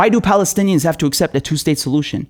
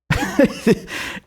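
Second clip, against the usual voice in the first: scoffing laugh; 6 semitones higher, 6 dB quieter than usual. Real, not acted. Scoffing laugh after quote.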